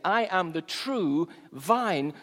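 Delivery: "true" held long at a slow pace; slow, weighty emphasis on "true"; stress on true. Shown as a demonstration. Preacher quotes Jesus on the vine, stress on "true". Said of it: "emphasis on the word true".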